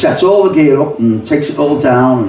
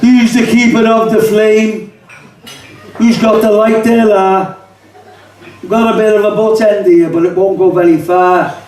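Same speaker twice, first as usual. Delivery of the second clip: mock a young Scouser offering his butt end; 3 semitones higher than usual. Poet voicing a young Scouser offering a smoke. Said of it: "This is called the kidder conversation".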